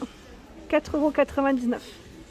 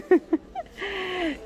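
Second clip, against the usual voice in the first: laugh; 7 semitones higher than usual. Real, not acted. Laughing at tempting biscuit jar.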